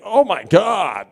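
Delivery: a hammed-up suspicious date; mock accusation. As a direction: accuse the date half-jokingly.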